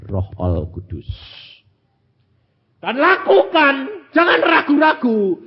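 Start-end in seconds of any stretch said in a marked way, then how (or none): 2.8-5.4 high, loud and slow; forceful preaching. Real, not acted. Preacher urging the congregation, loud, high and slow. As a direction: drive it home to the congregation.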